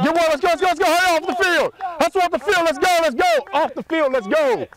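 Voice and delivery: loud, high coach yell. Real, not acted. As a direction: bark them off the field. Coach hurrying players off field.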